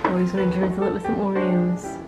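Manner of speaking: normal talking voice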